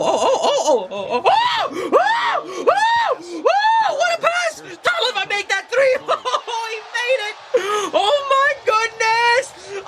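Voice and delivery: very high squealing whoops; ecstatic screaming. Real, not acted. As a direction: lose it completely, shrieking with joy. Fan erupting as a play unfolds.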